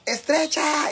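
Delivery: high-pitched mock catcall; drawn-out, high imitation shout; imitated street shout. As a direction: call it out like someone yelling in the street. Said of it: "me gritan".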